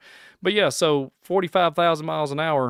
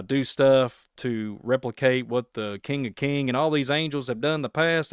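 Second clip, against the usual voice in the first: ordinary talk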